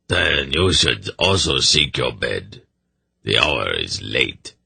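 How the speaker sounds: low, grave raspy retainer; deep, measured old servant; grim seneschal. Acted. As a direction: advise the king in a sombre rasp. Seneschal's rasping voice.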